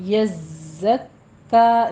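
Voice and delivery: यज़्ज़क्का with a whistling doubled ज़, held; tajweed demo. Shown as a demonstration. Teacher shows the whistling zay, slow. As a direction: hiss the ज़ in यज़्ज़क्का and pause on it. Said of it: "ज़य के ऊपर सीटी की आवाज़ लेकर आनी है".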